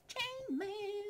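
very high, drawn-out silly squeal. Pretend. Gamer thrilled with new armour, absurd high squeal. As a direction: squeak it out in tiny-voiced glee.

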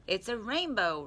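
a touch of gleeful calling out; excited shout. A narrator's reading. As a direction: exclaim it like a thrilled little character. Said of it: "Roo shouted excitedly".

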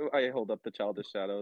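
ordinary talk, nothing marked

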